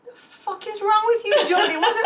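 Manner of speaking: high mock-annoyed husband; lightly voiced husband snapping; husband yelling. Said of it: "he's like".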